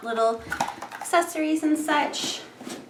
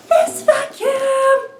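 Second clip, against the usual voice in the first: much higher, slow and drawn out; excited reveal; 14 semitones higher, 9 dB louder, much slower than usual. Real, not acted. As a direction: present it like a prize. Grand reveal of new vacuum.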